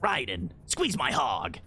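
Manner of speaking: low, slow gruff Snake-style growl; David Hayter impression. Streamer imitating the meme video, slow low voice. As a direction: drag it out like Solid Snake. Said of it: "like, David Hayter going".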